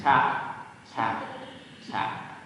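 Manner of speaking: soft taps, fading away; gentle tapping. Acted. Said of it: "a gentle tap at the door".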